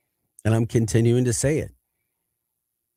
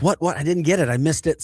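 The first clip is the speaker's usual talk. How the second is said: a lightly voiced, higher-pitched frantic linebacker who missed the call; mock-panicked questions, pitched up; frantic teammate; 7 semitones higher than usual. Linebacker in the huddle who missed the signal, frantic. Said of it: "kinda frantic".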